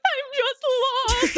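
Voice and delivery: slow, high, loud mock wail; retold crying. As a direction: drag it out in a tearful wail. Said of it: "I cried".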